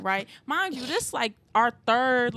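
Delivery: ordinary talk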